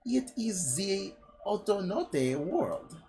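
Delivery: put-on slow French accent, leaping high. Disguised woman explaining the alternate world, mock French accent. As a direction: stretch it out in a cartoon French accent. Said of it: "my voice just goes from French stereotype".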